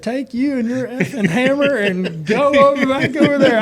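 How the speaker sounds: high, loud mock yell telling him off; furious retold yell. Racer retelling how he told his rival off, in a high loud yell. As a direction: bark it back like a furious racer. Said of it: "I was so mad".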